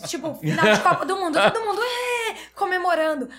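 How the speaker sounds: overflowing joy at the birth; joyful excitement. Real, not acted. Mother recalling her son's first cry, laughing and tearful. Baby born crying, joyful.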